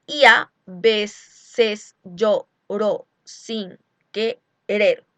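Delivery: very slow syllable count, "a veces" drawn together; slow teaching scansion with the vowels merged; scanned with synalepha. Shown as a demonstration. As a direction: spell out the metre slowly, joining the vowels. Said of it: "Así que lo voy a juntar".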